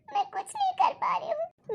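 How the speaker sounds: crying. Acted. Daughter breaks down before parents.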